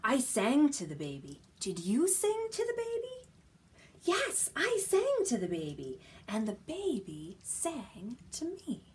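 singsong storybook voice for little ones; reading to a toddler. Acted. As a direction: read it playfully, question and answer. Reading picture book to toddlers.

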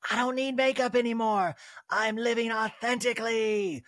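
airy self-satisfied parody; mock Kyle. Mocking Kyle's no-makeup stance.

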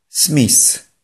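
"Smith" said as "smis", TH turned to S; mispronounced Smith. Shown as a demonstration. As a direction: end "Smith" on a hissing S. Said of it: "Nieprawidłowa jego wymowa może brzmieć". (błędna wymowa: "Smith" jak „smis”, z S na końcu)

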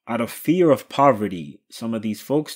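usual speaking voice

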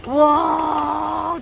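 ordinary delivery

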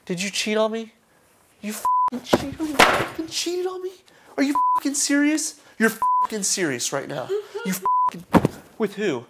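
high, strained, cracking yell; shrill sobbing outrage. Real, not acted. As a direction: demand it, voice breaking. Boyfriend demands the truth.

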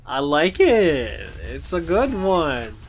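loud, slow gleeful gloat. Real, not acted. Gamer gloats over a good kill, slow and loud. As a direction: savour it slowly.

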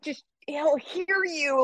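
high, slow, stretched-out warning; imitating a mortified student, pitched well up; panicked warning. Student warning instructor the judge will hear, panicked.

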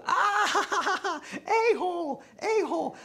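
put-on very high, laughing child voice repeating a-hole; laughing kid. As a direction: mimic a giggling kid. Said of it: "And he goes".

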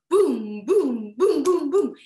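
loud gorilla boom-boom sounds; chest-beating booms. Pretend. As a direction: punch out each boom like a chest thump.

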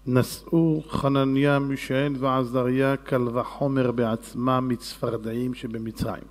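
slow, low, measured reading; Talmud recitation. Acted. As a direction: intone the text slowly in a low voice. Recites the Gemara passage.

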